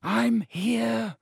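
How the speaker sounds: lightly voiced Ron, muffled. Ron answering from behind rock, muffled. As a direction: call back from behind rock. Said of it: "came Ron's muffled voice".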